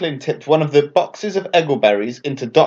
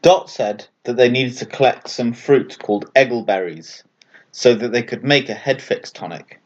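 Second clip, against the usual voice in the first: plain reading voice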